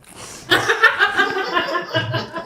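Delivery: bursting into high laughter; amused giggling laugh. Real, not acted. Laughing at stream button question.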